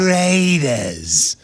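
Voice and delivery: slow, eerie drawn-out announcer call; horror movie voice. Retold or imitated. Correcting the co-host's Berman, slow and spooky. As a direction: drag it out like a horror trailer. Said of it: "why did you say it like a horror movie".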